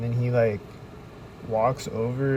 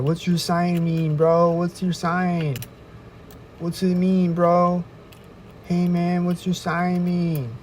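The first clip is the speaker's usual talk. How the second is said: higher, slow, drawn-out pestering; mock pushy stalker; 7 semitones higher, slower than usual. Stalker walks up demanding what the sign means, mocking. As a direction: play the nagging stranger, stretching each question.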